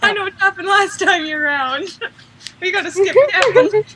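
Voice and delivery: high, giggly pretend panic; mock alarm. Fan imagines characters running into each other again, mock alarm. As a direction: play hammed-up dismay through laughter.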